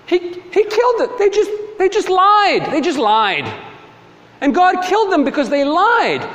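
high-pitched, hammed-up disbelief; mock outrage. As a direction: mimic a scandalized objector, voice climbing very high. Mocking people shocked at God's judgment.

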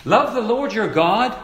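insistent preacher, swelling on every key word; emphatic preaching. Real, not acted. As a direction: drive the verse home phrase by phrase. Preaches the commandment phrase by phrase.

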